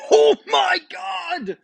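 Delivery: high, loud, drawn-out shriek of joy; ecstatic shout. Real, not acted. Gamer shrieking at a god roll, ecstatic. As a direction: scream it like you just hit the jackpot. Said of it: "I'm giddy right now".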